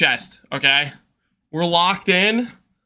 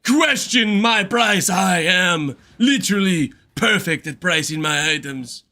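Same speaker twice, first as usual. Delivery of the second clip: mock offended seller. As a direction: ham up a pompous offended seller. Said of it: "Some people are like".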